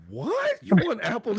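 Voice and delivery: high, shrieking disbelief; wife hears her son won AirPods, shocked squeal; mock shock. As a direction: squeal it like you can't believe it. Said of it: "she goes".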